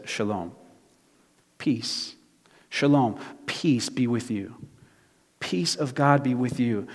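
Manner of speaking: soft, unhurried blessing with pauses; calm them with a quiet greeting; gentle reassurance. Retold or imitated. Jesus greeting his frightened disciples, gently reassuring.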